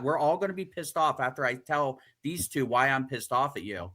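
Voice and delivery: ordinary delivery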